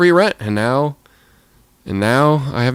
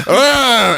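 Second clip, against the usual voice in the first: loud, much higher hammed-up "Arr"; mock monster roar; 11 semitones higher, 4 dB louder than usual. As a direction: roar like a cartoon monster crashing in. Monster bursts through the window.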